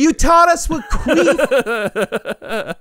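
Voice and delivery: high-pitched, exaggerated protest; mock accusation. Son pretends to confront mom over a word, in mock accusation. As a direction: pitch it up like an aggrieved kid blaming a parent. Acted complaint to mom, amid laughter.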